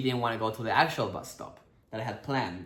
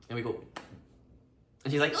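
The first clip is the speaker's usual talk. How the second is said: ordinary delivery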